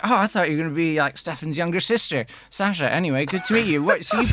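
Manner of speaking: a hammed-up British instructor, cheery; driving instructor greets his new pupil, in a bad British accent; terrible British accent. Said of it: "I'm gonna probably do some terrible British accents now".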